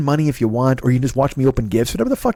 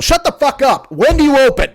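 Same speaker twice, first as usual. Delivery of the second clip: angry yelling; 11 semitones higher, 4 dB louder than usual. Acted. Yelling back at the pizza place.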